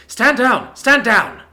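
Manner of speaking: loud high urgent shout; shouted stand down. A narrator's reading. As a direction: shout them down, fast and high. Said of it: "Romelia shouted".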